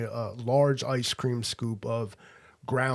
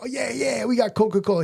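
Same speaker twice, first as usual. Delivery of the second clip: eager waiter; 10 semitones higher, 4 dB louder than usual. Retold or imitated. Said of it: "He was into it".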